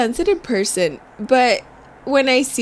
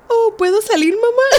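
meme voice; 11 semitones higher than usual. Retold or imitated. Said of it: "it said".

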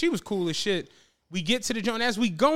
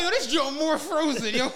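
laughing; 5 semitones higher than usual. Retold or imitated. Retold reaction to cold pizza.

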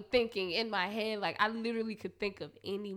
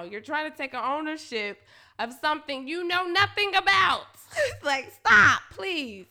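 higher, louder laughing scold; loud telling off; 5 semitones higher, 9 dB louder than usual. Retold or imitated. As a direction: put him in his place, laughing. Retold telling him off.

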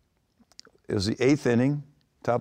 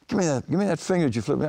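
higher, pushy demand; mock angry demand; 7 semitones higher than usual. Demanding the finger.

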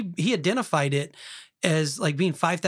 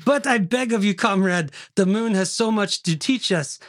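put-on hammy begging in a Russian accent. Begging a comrade not to quit, Russian accent. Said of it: "Did you do a good Russian accent".

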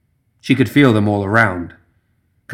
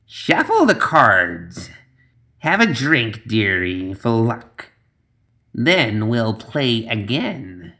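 put-on old witch voice; much slower than usual.

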